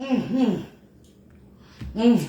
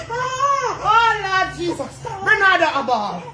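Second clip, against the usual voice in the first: frantic mother; 7 semitones higher, slower than usual. Acted. Mother fussing over her crying baby Bernardo, high and frantic.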